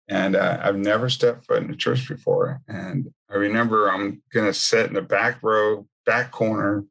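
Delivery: usual speaking voice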